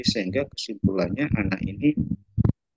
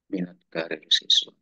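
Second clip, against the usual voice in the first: ordinary talk, nothing marked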